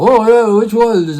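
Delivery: put-on Russian accent. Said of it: "in his Russian accent".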